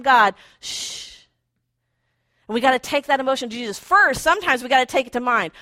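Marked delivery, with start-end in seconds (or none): 0.6-1.3 unvoiced hush; a breathy shh to silence it; whispered shush. Shown as a demonstration. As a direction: shush the noise away. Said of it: "Perfect time to exercise Psalm forty-six ten".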